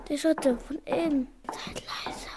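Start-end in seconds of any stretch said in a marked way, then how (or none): 1.5-2.3 hushed warning to the others; urgent whisper. Acted. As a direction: whisper it so no one hears. Hört Schritte, mahnt zur Ruhe. (eindringlich geflüstert)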